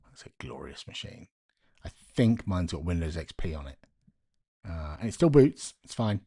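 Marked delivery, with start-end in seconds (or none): 0.1-1.3 reverent whisper. Real, not acted. Adoring whisper about laptop.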